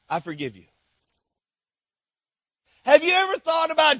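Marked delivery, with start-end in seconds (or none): 0.1-0.7 gentle Jesus. Acted. Jesus voiced on the cross.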